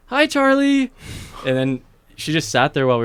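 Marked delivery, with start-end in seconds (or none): 0.1-0.9 put-on high, loud little-kid squeal; little girl's greeting. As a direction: chirp it like an excited small child. Child greeting acted out.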